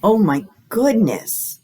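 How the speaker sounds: bright, sing-song storytime read; reading to children. Acted. Librarian reading a guessing book to children, sing-song. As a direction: read it like a picture book to little kids.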